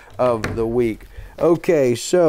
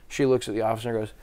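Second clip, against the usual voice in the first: usual speaking voice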